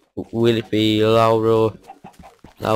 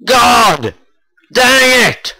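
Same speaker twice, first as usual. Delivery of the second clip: frustrated outburst; 12 semitones higher, 5 dB louder, slower than usual. Real, not acted. Just died in the game.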